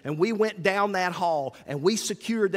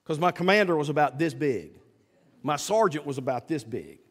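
usual speaking voice

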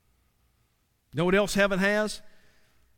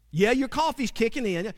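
ordinary delivery

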